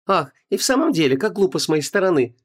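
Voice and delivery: high, chirping, sugary voice; cartoon princess. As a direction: chirp it sweetly, mock-girly. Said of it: "прощебетала Ванесса".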